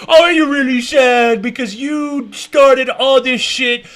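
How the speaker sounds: high, slow sarcastic pity; slow mock sympathy, dripping sarcasm. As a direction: fake concern for the guest. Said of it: "He's acting like he's sad".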